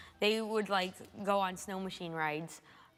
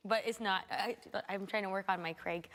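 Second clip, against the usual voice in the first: ordinary talk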